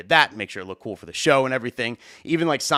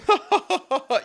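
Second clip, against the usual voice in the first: high, overdone suck-up laugh; forced fawning laugh; fake laugh. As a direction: laugh way too hard at a non-joke. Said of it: "overly just laughing".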